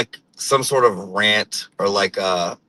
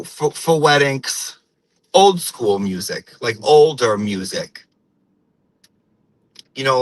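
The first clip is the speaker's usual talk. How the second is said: put-on old-school Portuguese-American accent, hard word endings; imitated Portuguese-American mother telling everyday stories; Portuguese-American woman. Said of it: "I could fucking talk like this".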